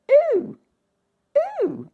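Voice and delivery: put-on high, breathy-free wondering ooh of a young princess; curious princess. A narrator's reading. Princess finding the spinning wheel, high and wondering. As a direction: lift the voice high and marvel at the strange thing. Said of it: "said the princess".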